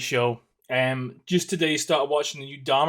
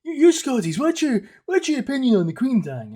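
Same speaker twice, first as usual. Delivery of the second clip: much higher, louder mock-nosy voice; mocking the askers; 10 semitones higher, 4 dB louder than usual. Parodying people asking a Scot about the Queen, high mocking voice. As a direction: squeak out the nosy question. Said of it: "everyone kept asking me".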